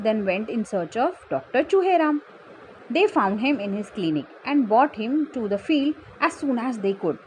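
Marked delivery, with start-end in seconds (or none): none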